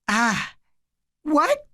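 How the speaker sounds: put-on high, weak little-boy voice; small, high, bewildered voice; dazed little boy. A narrator's reading. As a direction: come round confused, voice lifted high. Dazed boy comes out of trance.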